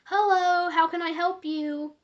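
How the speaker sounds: retold polite phone-answer voice; customer service greeting. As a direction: chipper at-work hello. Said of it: "I responded with my normal".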